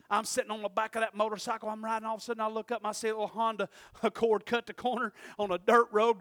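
ordinary talk, nothing marked

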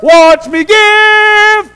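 slow, loud, high boastful drawl; show-off voice. Retold or imitated. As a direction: strut it like a peacock. Mocking showy giver.

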